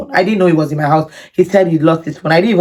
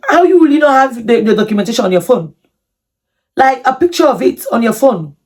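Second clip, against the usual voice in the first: incredulous; 5 semitones higher than usual. Retold or imitated. Disbelief at his missing documents.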